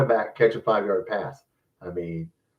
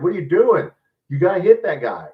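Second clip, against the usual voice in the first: exasperated yell; 7 semitones higher, 5 dB louder than usual. Retold or imitated. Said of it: "That's when they said".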